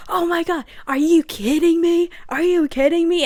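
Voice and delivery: high, loud mock outrage; hammed-up angry boyfriend yelling; mad. Boyfriend yells when Addie stops, mock furious. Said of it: "he gets so mad".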